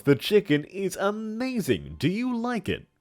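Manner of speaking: put-on big theatrical old villain voice; hammy stage actor. Plays a hammy character in chat.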